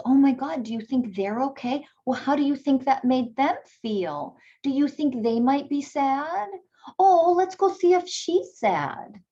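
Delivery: put-on high, bright coaxing voice to a toddler; sing-song parent voice. Shown as a demonstration. Parent coaching a toddler on empathy.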